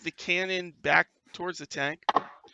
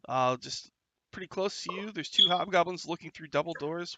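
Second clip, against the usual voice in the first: ordinary delivery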